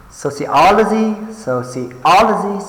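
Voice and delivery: sociology with OL high, loud and held; soci-OOL-ogy, punched high on OL; stress on third syllable. Shown as a demonstration. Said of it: "the third one".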